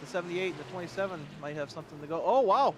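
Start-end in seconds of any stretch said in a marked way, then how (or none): 2.2-2.8 loud, high burst of surprise; startled, excited exclamation; astonished outburst. Real, not acted. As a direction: react to a sudden last-corner pass. Surprised by last-corner pass.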